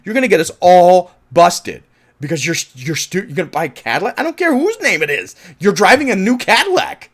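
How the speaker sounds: loud, high furious scolding; angry rant. Retold or imitated. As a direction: yell at a careless crew member. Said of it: "how I would feel. Like".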